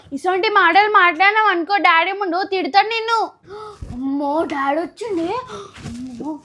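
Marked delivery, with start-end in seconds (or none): 3.9-6.4 low, soft frightened "అమ్మో"; scared gasp. Acted. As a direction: shrink back in fear. Sister scared of dad coming. (భయంతో మెల్లగా)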